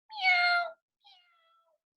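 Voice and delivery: very high thin meows; kitten meow. A narrator's reading. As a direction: mew like a restless kitten.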